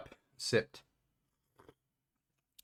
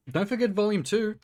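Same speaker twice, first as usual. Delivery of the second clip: excited; 10 semitones higher than usual. Real, not acted. Eager reminder to chat.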